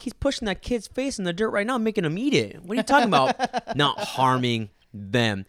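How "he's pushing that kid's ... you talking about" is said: disbelieving and getting angrier; incredulous outrage. Retold or imitated. To the careless mom, high-pitched outraged disbelief. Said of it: "I'm like".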